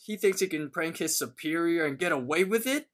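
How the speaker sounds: a hammed-up furious rant, not full volume; retold screaming. Muscle Man screaming about Thomas's prank, mock angry. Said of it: "he starts screaming".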